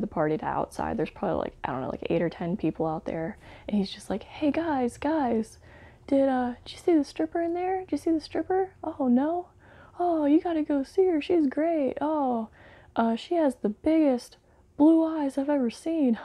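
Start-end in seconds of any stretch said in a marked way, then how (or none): 4.2-16.2 sleazy comic. Retold or imitated. Stand-up comic hyping the stripper to the party guests, goofy high voice. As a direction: ham up a cheesy club comic selling the stripper.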